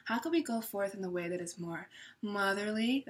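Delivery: soft tender queen voice; nurturing like the queen. Acted. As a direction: speak softly as a caring mother would. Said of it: "I'm even speaking like her, more passionate, more nurturing".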